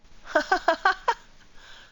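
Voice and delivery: loud high laugh, rising; high burst of laughter. Real, not acted. Laughing at game moment.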